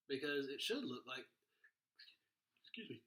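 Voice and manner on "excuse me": ordinary talk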